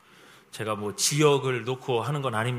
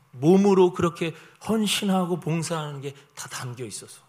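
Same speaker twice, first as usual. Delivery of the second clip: ordinary delivery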